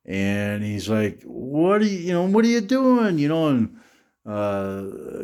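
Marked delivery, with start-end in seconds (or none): 1.2-3.2 high angry dad. Retold or imitated. Dad confronting drunk son.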